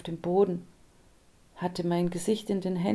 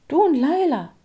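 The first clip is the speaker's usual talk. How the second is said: loud, much higher disbelief; can't believe it; man doubting his neighbor knows Laila; incredulous; 9 semitones higher, 9 dB louder than usual. A narrator's reading. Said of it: "fragte ich ungläubig".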